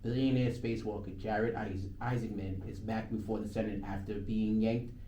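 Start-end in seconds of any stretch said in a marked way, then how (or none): 0.0-3.4 steady on-air news voice; reading the newscast on air; radio news read. Real, not acted. DJ reading the news update to listeners, on-air voice.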